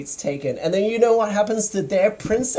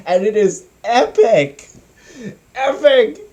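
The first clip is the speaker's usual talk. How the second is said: excited; 4 semitones higher, 4 dB louder than usual. Real, not acted. Raving about favorite scene.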